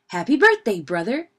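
high, loud birthday shout; shouted cheer. A narrator's reading. Party guests greeting Brother with the cake, shouted. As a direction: call it out loudly to the birthday cub. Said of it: "shouted the party guests".

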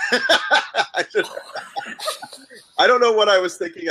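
laughing through the words. Real, not acted. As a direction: laugh while you say it. Laughing at her own story.

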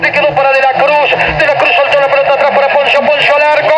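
rising play-by-play. Real, not acted. Play-by-play building to a goal.

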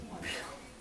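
short embarrassed giggle; nervous laugh. Acted. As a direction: giggle to cover the awkwardness. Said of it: "I'm sorry, I'm being".

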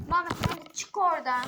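loud call across the room; shouting. Real, not acted. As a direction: shout at him to come out. Calling out to hiding player.

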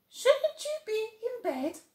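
a tiny high animal asking; bush baby voice. Acted. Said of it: "she asked".

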